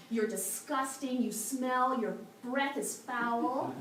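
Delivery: scornful scolding, insults hit hard; acted harsh dressing-down, punching the key words; stern, angry monk. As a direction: berate him with cold disgust. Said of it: "became stern and cold and angry".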